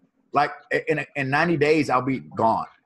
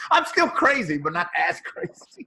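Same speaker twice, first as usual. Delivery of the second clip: giggly line jumping high then slowing down; laughing; 5 semitones higher, 4 dB louder than usual. Real, not acted. Joking to the host about still being crazy, laughing. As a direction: laugh it out, admitting it playfully.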